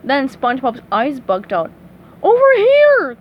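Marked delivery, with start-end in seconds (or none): 2.2-3.2 very loud high holler; drawn high call. A narrator's reading. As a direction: shout across to get attention. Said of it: "called Patrick".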